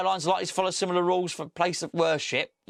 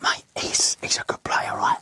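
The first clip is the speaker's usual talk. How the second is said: a fan whispering to his mate in the stands; hushed, breathy terrace whisper; mock whisper. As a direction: whisper it so nobody catches it. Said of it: "You've got to whisper".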